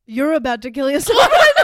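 loud, high, laughing punchline; laughing outburst. Real, not acted. Co-host jokes about friend's breakdown, loud laughing outburst. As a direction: blurt it out, breaking into big laughter.

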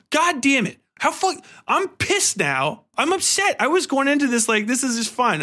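normal talking voice

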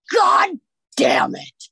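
high, loud, slow drawn-out groan of frustration; exasperated curse. Retold or imitated. Retelling her pregnancy news, a high loud exasperated curse. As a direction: let it out like the worst news just landed.

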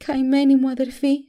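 crying. A narrator's reading. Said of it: "κλαίγοντας".

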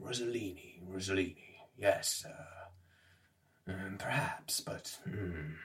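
very soft, broken murmur trailing into whisper; barely audible pondering; muttering to himself. Acted. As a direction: mumble it under your breath, thinking. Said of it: "he mutters to himself".

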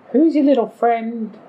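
put-on high, curious Scottish old lady. A centaur woman asking after the pet, high Scottish old lady voice. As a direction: coo it high as a Scottish granny. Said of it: "one of the other ones goes".

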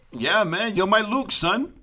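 high, loud playful declaration; playful exclaiming. Pretend.

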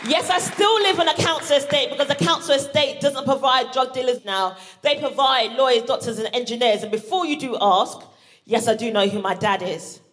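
usual speaking voice

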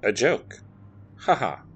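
mild deadpan ha ha; unamused. Acted. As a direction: say the laugh without any humour. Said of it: "in a tone that did not seem amused".

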